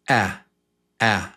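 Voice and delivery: quick clipped "a"; short A. Shown as a demonstration. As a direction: pop the "a" short. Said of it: "quick A".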